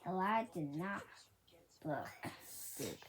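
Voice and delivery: usual speaking voice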